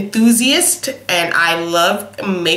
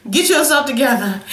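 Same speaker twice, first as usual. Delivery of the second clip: raised pitch, laughing through the words; 6 semitones higher than usual. Real, not acted. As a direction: scold yourself while cracking up. Laughing at herself.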